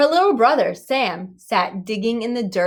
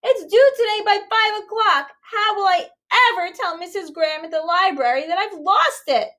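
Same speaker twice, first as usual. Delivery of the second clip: put-on distressed girl; 11 semitones higher, 3 dB louder than usual. A narrator's reading. Stella distressed about lost book.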